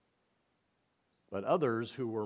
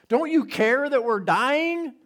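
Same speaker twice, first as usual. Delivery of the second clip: much higher, louder, slow desperate cry; frantic plea; 13 semitones higher, 11 dB louder than usual. Retold or imitated. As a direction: beg him to wake up, terrified. Disciples waking Jesus in storm.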